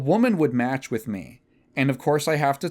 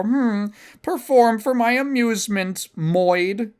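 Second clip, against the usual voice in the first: a dismissive, haughty voice, pitched up; mock women on Tinder; 7 semitones higher than usual. Mocking a Tinder match's demand. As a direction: sneer it like a bored match. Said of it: "They just go".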